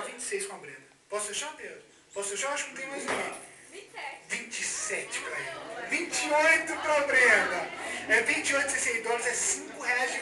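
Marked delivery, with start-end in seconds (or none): none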